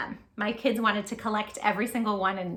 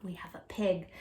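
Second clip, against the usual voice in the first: whisper; 4 semitones lower, 7 dB quieter than usual. Shown as a demonstration. Reading a board book to children.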